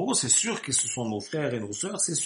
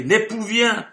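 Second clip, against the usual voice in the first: loud proclamation; 7 semitones higher, 8 dB louder, slower than usual. Acted. Preacher crying out the herald's call to the sleepers, loud and high.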